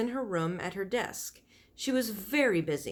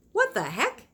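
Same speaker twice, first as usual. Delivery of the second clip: startled; 6 semitones higher, 3 dB louder than usual. Acted. Loud noise startles Flora.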